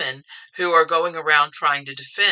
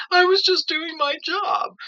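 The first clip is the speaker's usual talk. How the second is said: high, louder mocking voice, slow on the quote; mocking excuse; 12 semitones higher, 3 dB louder than usual. Mocking the Nuremberg defendants' excuse, high and loud. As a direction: sneer out the old excuse. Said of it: "Their excuse was".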